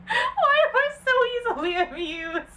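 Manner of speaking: very high, loud laughing squeal; squealing laughter. Real, not acted. Reading the God Fieri tweet, squealing with laughter.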